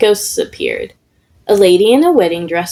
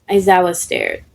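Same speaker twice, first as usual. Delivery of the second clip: ordinary delivery, nothing marked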